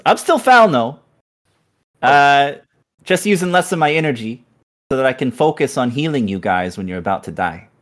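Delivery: very high, loud squeaky spirit; piping ghostly skull talking; shrill skull voice. Acted. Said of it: "The skull turns to look at you and says".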